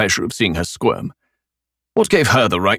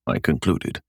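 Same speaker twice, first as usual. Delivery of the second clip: usual speaking voice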